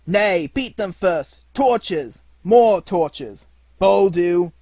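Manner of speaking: loud, rough crowd yells; mob crying out. Acted. Villagers storming the hut, shouting loudly. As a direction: roar it like an angry torch-waving mob. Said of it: "and they cried".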